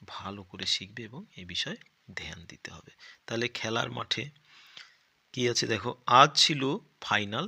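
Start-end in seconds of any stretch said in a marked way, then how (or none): 0.1-2.9 hushed unvoiced aside; confiding whisper to the class. Real, not acted. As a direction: whisper it like a secret tip. Teacher stresses an important point.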